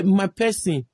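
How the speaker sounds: usual speaking voice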